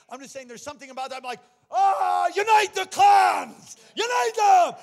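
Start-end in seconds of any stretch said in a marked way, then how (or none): 1.7-4.7 full-force high shout, drawn out; rally the troops with a huge roaring yell; battle cry. Acted. Acts out Braveheart battle cry.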